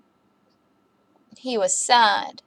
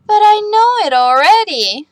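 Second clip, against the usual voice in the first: put-on higher, louder little boy complaint; whiny child protest; 9 semitones higher, 4 dB louder than usual. Max protesting to his teacher, higher childish whine. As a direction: object like a small boy.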